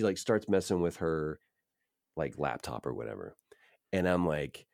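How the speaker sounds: ordinary talk, nothing marked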